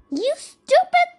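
high, loud storybook telling-off; exclaimed reproach, pitch leaping up; scolding. A narrator's reading. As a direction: scold sharply as the mother mouse. Mother mouse scolds her child.

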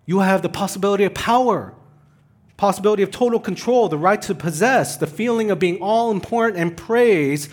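loud, high-pitched sermon crescendo; forceful preaching. Real, not acted.

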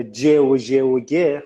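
ج و ژ و گ spelled out slowly, one sound at a time; letters laid out like a lesson; slow sound demo. Shown as a demonstration. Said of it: "خب خیلی نزدیک همه".

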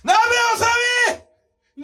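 high excited shout; shouted prompt. Real, not acted. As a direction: yell the name high. (高い声で叫ぶ)

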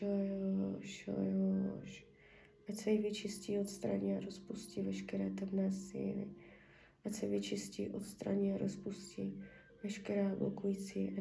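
soft, murmured ritual prayer; healing prayer. Acted. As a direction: pray over her quietly, half whispered. (tichá léčitelská modlitba)

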